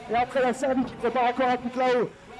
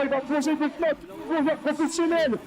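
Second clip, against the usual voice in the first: crowd hype announcer; 4 semitones higher than usual. Real, not acted. Announcer rousing Nantes for Nicolas's dive, hyped up. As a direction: whip the crowd up into repeated urging cries.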